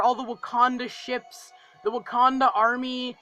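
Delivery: usual speaking voice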